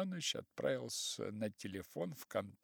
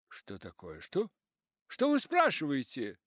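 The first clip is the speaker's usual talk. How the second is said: irritated; 3 semitones higher, 7 dB louder than usual. A narrator's reading. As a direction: demand it with growing impatience. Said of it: "раздражился Персиков".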